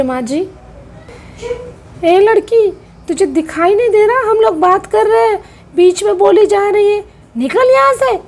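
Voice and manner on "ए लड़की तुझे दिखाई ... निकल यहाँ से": angry yell. Acted. Aunt yells at maid.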